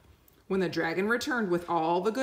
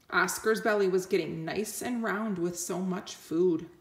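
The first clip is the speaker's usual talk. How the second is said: usual speaking voice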